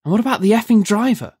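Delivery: high, rapid indignant question; quick, exasperated complaint. A narrator's reading. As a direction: fire it off in rising outrage. Incensed character blames the driver.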